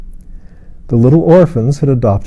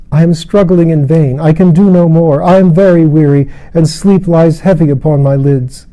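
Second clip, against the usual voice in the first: higher, louder weary strain; straining despair; 6 semitones higher, 4 dB louder than usual. A narrator's reading. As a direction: fight and give up. Lilith straining to open hand.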